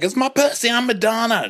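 high, loud proud diva. Retold or imitated. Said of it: "She's like".

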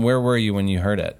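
ordinary delivery, nothing marked

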